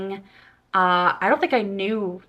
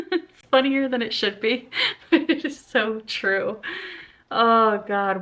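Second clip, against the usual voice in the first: laughing; 2 semitones higher than usual. Real, not acted. Laughing at review.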